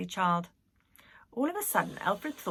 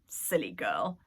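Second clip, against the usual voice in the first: lower dismissive Nanny; 9 semitones lower, slower than usual. A narrator's reading.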